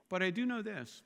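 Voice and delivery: ordinary delivery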